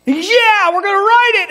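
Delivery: put-on dad joining the boy's joy; excited dad. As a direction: cheer along with your son.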